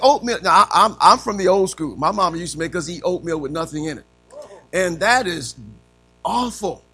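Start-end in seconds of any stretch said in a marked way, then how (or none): none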